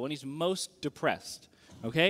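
usual speaking voice

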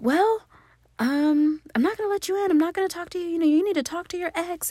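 high, put-on imitation of mom; mocking mom voice. Mom refusing to let her in, high mocking imitation.

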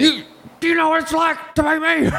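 high-pitched, put-on outburst; mock shouting. Host joking about being banned podcasters, mock shouting. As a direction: cry out in hammed-up indignation. Acted outburst after Alex Jones joke.

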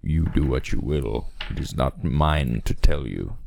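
low, slow cryptic sage; wise old man. Acted. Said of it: "to wise old man".

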